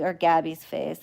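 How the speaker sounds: ordinary talk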